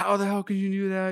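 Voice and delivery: high-pitched retold scolding; mock angry yelling. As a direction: imitate a mom telling someone off. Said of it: "like, yelling at her".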